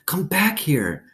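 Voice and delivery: put-on urgent girl voice. A narrator's reading. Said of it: "I hissed".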